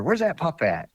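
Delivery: the puzzled buddy again, voice lifted; imitating Joe. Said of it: "And a few minutes later".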